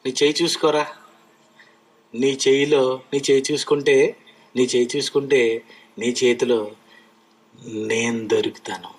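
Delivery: deep, hushed voice of God; voicing God. Preacher voices God telling man to look at his hand, deep and hushed. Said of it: "అన్నాడు".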